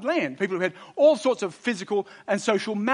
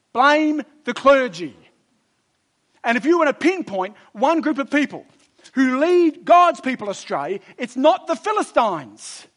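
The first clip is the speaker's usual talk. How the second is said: loud, emphatic, slowed preaching; preaching at full force; 6 semitones higher, 7 dB louder than usual. Acted. Preacher warning his brothers against the clergy, loud and emphatic. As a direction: hammer the point home from the pulpit.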